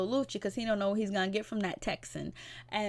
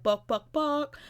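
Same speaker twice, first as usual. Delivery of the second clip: a mock rap ad-lib chant; imitating the cousin's ad-lib; 5 semitones higher, 3 dB louder, slower than usual. Vlogger imitates the cousin's rap ad-libs, mock chant. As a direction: bark it out like a hype man. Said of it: "I don't know how he says it".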